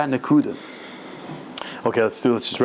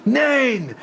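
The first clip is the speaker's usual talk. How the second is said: high, acted cry of defiance; retold scream, pitched way up; screaming refusal; 11 semitones higher than usual. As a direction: burst out against the tempters. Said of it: "he sees screaming".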